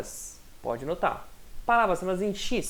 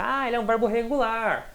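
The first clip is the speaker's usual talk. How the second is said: higher-pitched quote of a learner labelling a verb; imitated student remark; 5 semitones higher than usual. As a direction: voice it as someone else's realization, pitched up. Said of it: "tipo assim".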